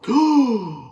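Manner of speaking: loud, high hammed-up gasp; exaggerated imitated gasp; mock gasp. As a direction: gasp like someone slapped her dog. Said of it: "Like somebody slapped her dog".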